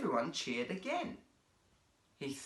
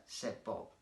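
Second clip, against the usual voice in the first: ordinary delivery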